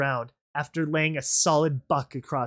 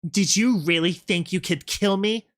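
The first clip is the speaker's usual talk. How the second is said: high, scornful question; mock the one who failed to hurt you; 5 semitones higher, 3 dB louder than usual. Rainbow Dash over the fallen rebel, mocking. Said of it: "Rainbow Dash's mocking response".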